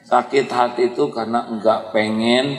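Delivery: ordinary talk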